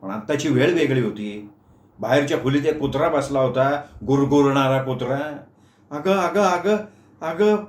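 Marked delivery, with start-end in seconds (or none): 5.9-7.6 higher frightened stammer; words stuck in panic; stammering in fright. Acted. Meenu too scared to speak, higher stammering. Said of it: "तोंडून शब्दच बाहेर येईन".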